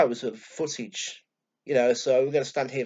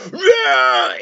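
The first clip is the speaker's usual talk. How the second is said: high, very loud mock scream; imitated full-blast "rah" scream; Shane Brannigan; 12 dB louder than usual. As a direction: roar it right in their face. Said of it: "proper screaming in your face".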